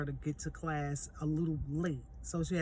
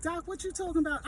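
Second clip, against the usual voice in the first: much higher, fast teasing question; joking disbelief; 11 semitones higher, faster than usual. Retold or imitated. Mom teasing the dentist in amused disbelief. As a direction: toss it at him like a playful jab.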